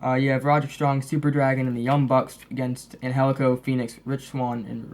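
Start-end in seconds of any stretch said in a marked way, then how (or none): none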